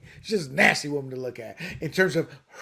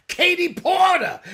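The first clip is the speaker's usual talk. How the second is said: mock shout; 13 semitones higher, 6 dB louder, slower than usual. Listing candidates.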